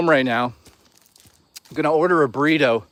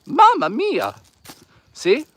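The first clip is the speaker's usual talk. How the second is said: high-pitched mock Italian exclamation; Italian accent; 10 semitones higher, slower than usual. Shown as a demonstration. Streamer proving he's Italian to viewers, cartoon Italian accent. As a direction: cry it out in a hammed-up Italian accent. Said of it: "Here's my Italian accent".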